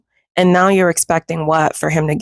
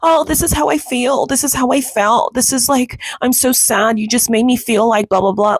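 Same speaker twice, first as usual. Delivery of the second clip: higher-pitched mimicry of someone oversharing feelings; mock emotional woman; 6 semitones higher than usual. Telling males your emotions, mocking higher voice. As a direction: parody a woman pouring out her emotions. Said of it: "You know, you keep tr".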